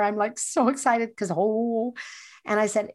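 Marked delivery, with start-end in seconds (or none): none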